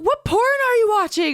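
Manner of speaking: high mock-shocked squeal; freaking out. Retelling the book twist, a high mock freak-out. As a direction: play her horror way up high. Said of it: "freaking out".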